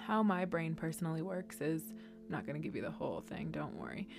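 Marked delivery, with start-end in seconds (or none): 2.2-4.1 fast, hushed aside; quiet aside. Acted. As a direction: toss it off under your breath. Quick aside.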